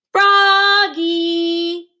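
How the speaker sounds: high, loud drawn-out name; reading aloud loudly. Acted. Froggy reading his name tag loudly. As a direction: proudly belt out his own name. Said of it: "He read it aloud, louder and louder".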